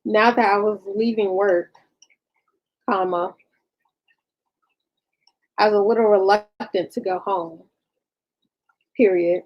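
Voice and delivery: halting dictation, speaking the punctuation aloud; dictating. Real, not acted. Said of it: "hear me dictating".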